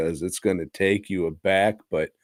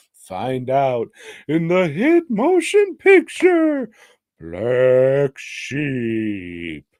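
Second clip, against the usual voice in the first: booming movie trailer announcer; 4 semitones higher, 4 dB louder, much slower than usual. Pretend. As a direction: sell it like a film trailer.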